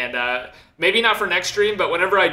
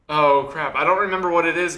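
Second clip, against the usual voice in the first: usual speaking voice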